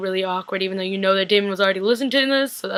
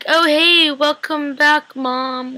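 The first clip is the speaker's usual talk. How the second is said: mock-cheery greeting; 5 semitones higher, much slower than usual. Viewer playfully welcomes back Mom, mock-cheery. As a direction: greet with exaggerated, stretched-out cheer.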